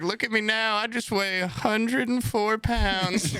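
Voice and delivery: put-on high, slow, sad old-lady voice; frail grandma. Grandson imitates grandma moaning about dialysis, high and frail. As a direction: mimic a frail, complaining grandma. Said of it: "she's like".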